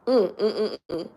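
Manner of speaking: soft crying hum fading very quiet; choked-up happy crying; tears of joy. Real, not acted. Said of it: "I done cried tears of joy".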